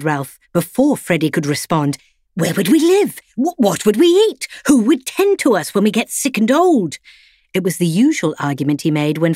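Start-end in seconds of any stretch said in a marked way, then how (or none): 2.3-7.0 higher, worried put-on dog voice; anxious loyal dog. A narrator's reading. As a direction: fret about food and home. Ralph character voice.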